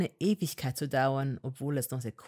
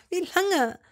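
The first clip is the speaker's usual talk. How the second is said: high, louder demand; insistent repeat; 14 semitones higher, 6 dB louder than usual. A narrator's reading. Hermine pressing Malfoy, high and loud. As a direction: press him again, higher. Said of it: "fragte sie erneut".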